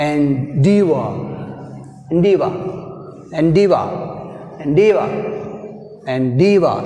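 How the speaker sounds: "endeavor" with DEA punched; weak en, strong falling DEA-vor in "endeavor"; second-syllable stress. Shown as a demonstration. Teacher drilling another example, "endeavor" stressed on DEA. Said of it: "we have stress on deavor".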